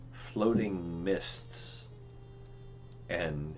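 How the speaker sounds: slow, hushed storyteller; dramatic narration. A narrator's reading. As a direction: draw the vision out slowly. GM describes overwhelming vision.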